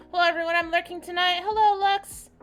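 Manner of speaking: high, loud, sing-out welcome; excited greeting. Real, not acted. Streamer greeting Lux arriving in chat, excited and loud. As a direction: call out a hello, pitched well up.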